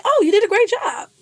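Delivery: high, loud and bright praise; genuinely warm praise. Retold or imitated. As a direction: cheer her on sincerely. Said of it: "not even in a condescending tone".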